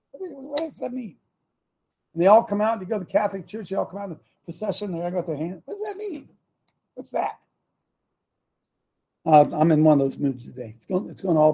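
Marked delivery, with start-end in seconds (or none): 0.1-1.2 mock puzzlement. Mimicking puzzled question about church ritual. 5.7-7.4 very high, soft put-on voice; mock puzzlement. Mimicking puzzled question about church ritual.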